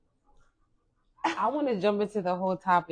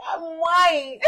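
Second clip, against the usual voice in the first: higher, louder mock wail; imitated crying child; 8 semitones higher, 6 dB louder than usual. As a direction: imitate a child's tearful protest. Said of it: "She was crying".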